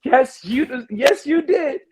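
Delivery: overjoyed fan yelling at the screen; excited cheering. Retold or imitated. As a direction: cheer him on like a thrilled fan. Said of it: "because I was like".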